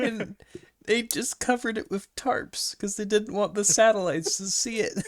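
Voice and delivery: high, loud, cracking up; laughing while talking. Real, not acted. Telling friend about the covered-up destroyer, laughing hard. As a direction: push the words out through real laughter.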